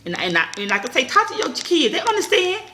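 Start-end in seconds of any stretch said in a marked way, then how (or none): 1.1-2.6 high emphatic advice. Real, not acted. Advising parents to talk to their kids, high and emphatic. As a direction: press it home to parents. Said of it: "advice to viewers".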